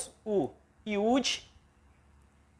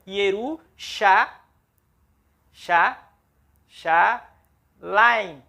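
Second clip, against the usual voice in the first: Ieru, chá, laim, each syllable separated and stressed; 4 dB louder, much slower than usual. Shown as a demonstration. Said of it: "Então, Ieru é a primeira sílaba". (sílaba por sílaba, destacando chá)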